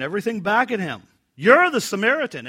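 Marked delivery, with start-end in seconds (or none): 1.4-2.5 loud, higher accusing cry of the crowd; accusing shout. Shown as a demonstration. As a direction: fling the charge back at him. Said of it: "And in fact, there's emphasis on it".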